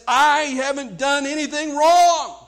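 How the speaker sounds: loud, high, drawn-out acted protest; self-righteous protest. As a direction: insist on innocence, almost smug. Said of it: "almost a smug, uh, self-righteousness".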